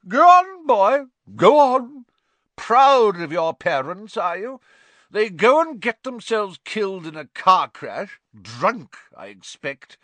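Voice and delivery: very high, loud taunt; Aunt Marge taunting. A narrator's reading. Aunt taunting Harry about his parents, very loud. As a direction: goad him like a cruel drunk.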